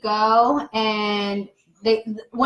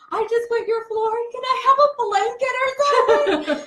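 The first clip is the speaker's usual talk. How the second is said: high, squeaky flustered apology; playing her younger self, embarrassed and frantic; high mock-panicked voice, half laughing; 11 semitones higher than usual. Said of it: "then I started freaking out".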